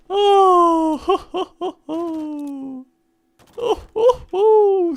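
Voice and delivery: high, loud gleeful cackle; real laughter at the fireworks; delighted laughter. Real, not acted. Delighted at the explosions.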